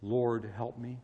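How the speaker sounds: soft, low whispered plea; quiet prayer. Acted. Preacher repeating the woman's prayer, soft and hushed. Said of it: "That's an eloquent prayer".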